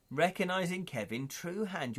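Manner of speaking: normal talking voice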